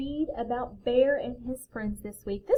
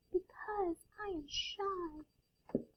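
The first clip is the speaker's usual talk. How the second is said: high, very soft, slow and timid; trembling shy voice; 9 semitones higher, 11 dB quieter, slower than usual. A narrator's reading. Hidden Owl to the friends, trembling and shy. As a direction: answer timidly, barely daring to speak. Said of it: "a trembling voice says".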